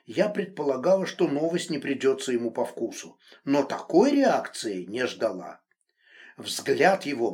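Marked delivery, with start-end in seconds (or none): none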